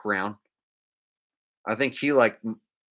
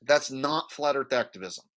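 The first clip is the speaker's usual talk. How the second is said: ordinary delivery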